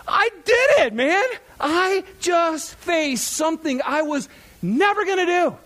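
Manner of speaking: high, loud victory cry; exultant triumph. Retold or imitated. Retold triumph on landing.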